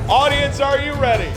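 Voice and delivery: high shouted call to the crowd; booming fighting game announcer. Real, not acted. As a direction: pump up the audience.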